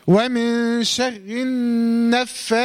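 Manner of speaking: slow chanted ومن شر النفاثات with a held nasal n; drawn-out recitation, linking شر straight into the n of النفاثات; two-count ghunna. Shown as a demonstration. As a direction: hum the doubled n of النفاثات for two beats. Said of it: "هنغنها بمقدار حركتين".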